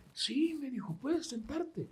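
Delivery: put-on soft, high tender old lady voice. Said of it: "con una voz bien tierna".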